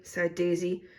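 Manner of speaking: usual speaking voice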